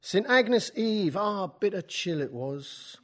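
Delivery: put-on slow Keats in a Cockney accent; drawn-out Cockney reading of Keats. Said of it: "I always do Keats in, Keats in a Cockney accent".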